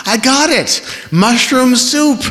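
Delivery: high, thrilled announcement; excited. A narrator's reading. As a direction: proudly show off the find. Kate triumphant with soup.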